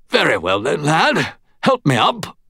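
a lightly voiced weary Englishman; tired old soldier asking; English gentleman. A narrator's reading. Wounded Stuart asking for help.

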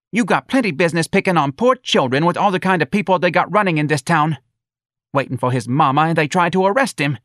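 high, loud indignant mother; protective mother. A narrator's reading. Mother confronting the policeman over her child, high and indignant. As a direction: challenge them in a high voice, defending your child.

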